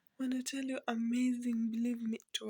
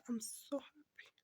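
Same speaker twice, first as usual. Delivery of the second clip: whisper; 8 dB quieter than usual. Real, not acted. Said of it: "I'm a proud mother".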